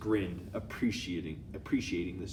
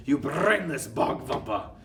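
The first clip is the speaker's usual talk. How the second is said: angry screech; 5 semitones higher, 7 dB louder than usual. A narrator's reading.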